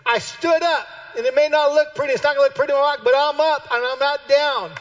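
loud, high, fervent preaching; preaching at full force. Acted. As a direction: preach it out to the leaders. Preaching climax.

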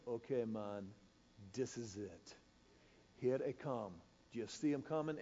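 tense hush as the fish come in; hushed guide. Retold or imitated. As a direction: keep it down so the fish don't spook. Guide crouches as fish approach.

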